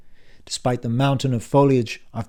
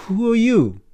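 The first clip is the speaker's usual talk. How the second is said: high, shaken cry of disbelief; startled fright; 11 semitones higher, 7 dB louder than usual. Acted. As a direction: gasp out the question in shock. Dan faces dead uncle.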